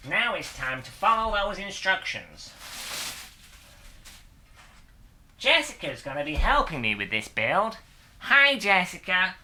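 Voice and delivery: peppy American presenter intro; American accent, hammed-up tutorial host delivery; put-on American accent. Builder presents the cockpit build to viewers, American accent. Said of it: "best American accent I could do".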